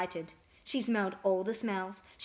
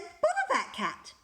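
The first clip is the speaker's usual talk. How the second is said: high, louder exasperated mother; cross mum voice; 12 semitones higher, 4 dB louder than usual. A narrator's reading. Mum scolding the cat, high and exasperated. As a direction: huff it out like a fed-up mum. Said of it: "Missus Thomas said".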